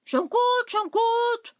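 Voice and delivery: very high, loud squeaky witch; high shrill-pitched hag brushing him off. Acted. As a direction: cackle it out like an impatient little witch. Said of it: "antwortet die Hexe".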